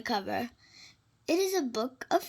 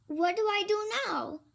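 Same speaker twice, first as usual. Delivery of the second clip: put-on curious kid; 7 semitones higher than usual. A narrator's reading. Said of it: "Pete asks".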